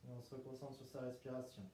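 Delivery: guided meditation. Acted. Leads breathing in relaxation.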